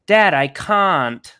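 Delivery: put-on slow, drawn-out British toddler whine; British toddler accent. Dad telling friends about his son, in a mock British toddler accent. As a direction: stretch it like a little British boy. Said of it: "he'll be like".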